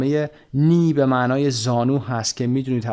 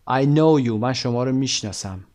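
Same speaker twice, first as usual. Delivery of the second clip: ordinary talk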